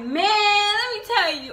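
high, drawn-out excited "Man". Shown as a demonstration. Vlogger demonstrates an excited use of man, high and slow. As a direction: sound thrilled and stretch it. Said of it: "you can be excited".

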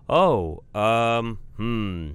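halting, stalling for an answer; undecided. Acted. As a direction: stall while working out a safe reply. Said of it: "Trying to decide what he's supposed to say".

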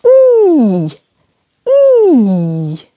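long, gliding "E" held like "ee"; long French E. Shown as a demonstration. Teacher shows learners today's French sound, long "E" said as "ee". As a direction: stretch "E" into a clear, drawn-out "ee". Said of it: "is quite a long E".

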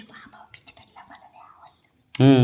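whispered pet voice. Pretend. Wife voicing the dog's reply in a slow whisper. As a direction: whisper it as if the dog were speaking.

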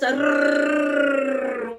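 long rolled r trill. Shown as a demonstration. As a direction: roll the r out long. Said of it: "Rolling my R's".

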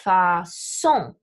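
"façon" said the French way, fa-SON; final-syllable stress on façon. Shown as a demonstration. Teacher giving French loan word example, "façon" stressed fa-SON. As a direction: slowly lean on the last syllable of "façon". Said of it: "emphasized on the final syllable".

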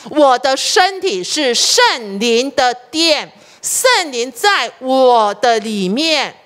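slow, deliberate affirmation; steady, measured self-declaration. Shown as a demonstration. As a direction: speak it slowly as a confession of faith. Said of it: "你只要告诉自己".

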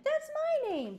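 high, loud excited call. Acted. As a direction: blurt it out across the room. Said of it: "Ben called out".

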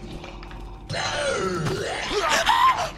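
high, startled shriek with a gasp; startled scream. Real, not acted. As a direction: shriek at a jump scare. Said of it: "it scared me".

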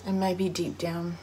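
reflective dramatic reading with long pauses; measured, pensive recital, fading at phrase ends; wistful monologue. Acted. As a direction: deliver a heartfelt soliloquy on loss, phrase by phrase. Performs a written monologue about loss.

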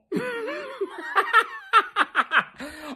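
high cackling laugh; gleeful laugh. Real, not acted. Fan cackling after taunting the Cowboys, gleeful laugh. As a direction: let the laugh burst out. Gloating over rival's loss.

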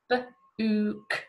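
b-ook split into b and a short oo; sounded-out book. Shown as a demonstration. Teacher sounds out b-ook for children to blend. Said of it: "Like the word".

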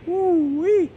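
high, loud whoop of delight; excited whoop. Real, not acted. Vlogger cheering himself on, a loud high whoop. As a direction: let out a joyful woo-wee.

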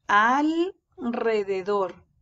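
"Alrededor" drawn out, pausing before a trilled R; strong rolled R. Shown as a demonstration. Teacher repeating the strong sound after L, slow rolled R. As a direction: slowly split "Alrededor" as al... rrededor. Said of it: "se lee con sonido fuerte".

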